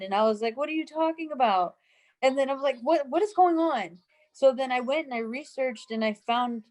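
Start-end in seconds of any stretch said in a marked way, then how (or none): 0.6-1.8 higher-pitched incredulous question; retold bafflement. Retelling her baffled reply to customer. 2.8-4.0 higher-pitched, stumbling disbelief; retold bewilderment. Retelling her confusion at the scene.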